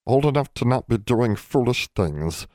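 a fussy elf scolding; house elf voice. A narrator's reading.

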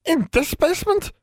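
very high, insistent question; high-pitched, alarmed demand. A narrator's reading. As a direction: demand an answer in a high elf voice. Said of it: "demanded Em".

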